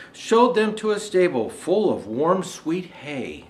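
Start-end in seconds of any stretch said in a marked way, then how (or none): none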